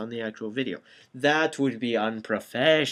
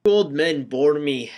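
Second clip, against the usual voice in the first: ordinary delivery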